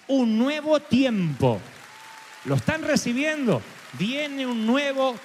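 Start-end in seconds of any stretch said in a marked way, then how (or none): 0.1-1.6 slow, emphatic declaration; prophetic proclamation. Real, not acted. Preacher delivering God's message to congregation, prophetic proclamation. 4.0-5.2 slow, higher-pitched, stretched declaration; prophetic proclamation. Real, not acted. Preacher repeating God's promise to congregation, prophetic proclamation.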